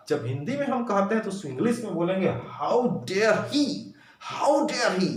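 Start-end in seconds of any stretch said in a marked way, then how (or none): none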